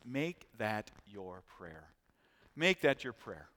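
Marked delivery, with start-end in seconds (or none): 0.1-1.9 slow and much softer, almost to himself; hushed exhortation. Shown as a demonstration. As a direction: let it sink in, slowed right down and soft. Repeated exhortation, first take hushed.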